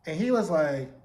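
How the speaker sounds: ordinary talk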